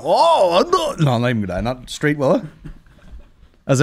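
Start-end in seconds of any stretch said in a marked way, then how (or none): 0.0-1.0 high mocking oh no; lightly mock the snoopers; mock spy voice. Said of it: "As usual".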